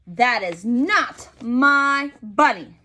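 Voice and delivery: put-on slow, emphatic little girl's protest; Trixie's cry. Trixie to daddy, slow indignant protest. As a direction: plant your feet and insist it's not her bunny. Trixie insists, quote in story.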